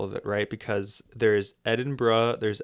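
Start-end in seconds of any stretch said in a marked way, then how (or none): 1.6-2.4 Edinburgh ending in a short "bruh"; correct local pronunciation. Shown as a demonstration. Listing variants for listeners, Edinburgh said as "Edin-bruh". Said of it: "Edinburgh is a great example".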